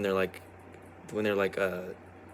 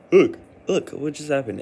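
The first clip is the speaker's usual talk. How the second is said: louder, higher, startled; suddenly amazed at yourself; surprised; 7 semitones higher, 8 dB louder than usual. Real, not acted. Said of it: "that was a real yawn".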